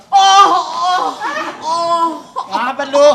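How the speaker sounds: loud, high gasping oh oh; overacted sinetron shock; soap opera gasps. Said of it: "biar dramaturginya ada".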